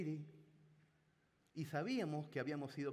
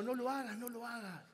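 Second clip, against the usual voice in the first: higher, mock urgent plea; pleading friend; 7 semitones higher than usual. Friend silently begging the prankster to stop, mock plea. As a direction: beg him to stop, half-hearted. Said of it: "como diciendo".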